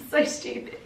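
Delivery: breathy laughing whisper through tears; tearful laugh. Real, not acted. Laughing at herself on camera, teary and whispered. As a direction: laugh it off while welling up. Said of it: "trying really hard not to get emotional".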